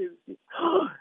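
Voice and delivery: sharp rising intake of breath; a sudden gasp of horrified recognition; shocked gasp. Retold or imitated. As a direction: gasp as the truth sinks in. Wealthy man recognizes the rabbi.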